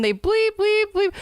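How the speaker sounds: comic self-censoring in rising bleeps; spoken censor bleeps. Acted. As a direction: run of high mock censor beeps. Censoring gruesome explanation with bleeps.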